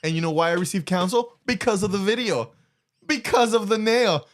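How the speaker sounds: gleeful payoff of the story; laughing triumph. Real, not acted.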